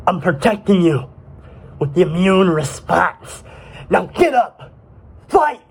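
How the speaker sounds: rough soldier voice building to a high shout; rally the troops into battle; military commander barking orders. Acted. Microglia gives battle orders.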